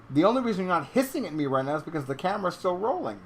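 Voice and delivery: loud, high, exasperated pet lecture; mock scolding. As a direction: playfully scold with theatrical exasperation.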